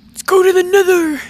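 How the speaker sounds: loud, high excited call; excited shout. Real, not acted. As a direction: belt it out with glee. Calling out, big energy.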